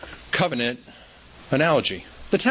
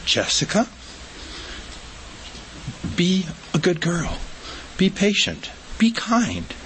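lightly voiced nurse, rising higher; coaxing nurse; 5 semitones higher than usual. Nurse coaxing the little girl, lightly higher voice. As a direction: coax her, a hint of a sweet nurse. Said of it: "She goes".

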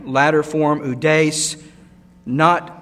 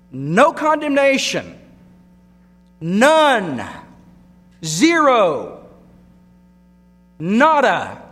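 full-force preaching; 3 semitones higher, 5 dB louder, slower than usual. Acted. Preacher declaring to the church there is zero condemnation, shouted. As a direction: shout every word of the list.

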